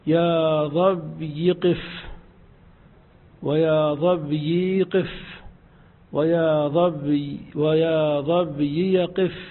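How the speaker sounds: "ظبي" said slowly then shifted to "ضب"; slow drill of ظبي. Shown as a demonstration. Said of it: "وتقول في الظبي". (نطق "ظبي" ببطء)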